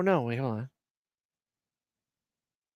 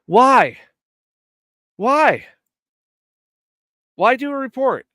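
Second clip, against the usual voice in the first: loud, higher, disbelieving; real outburst of disbelief, higher and louder; incredulous why; 7 semitones higher, 8 dB louder, slower than usual. Real, not acted. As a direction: shout it out in disbelief. Disbelief at the report.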